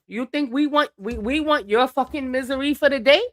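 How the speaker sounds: usual speaking voice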